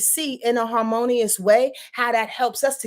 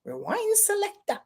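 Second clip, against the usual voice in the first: llamada de DJ; 11 semitones higher, 5 dB quieter than usual. Pretend. Calls out a DJ catchphrase.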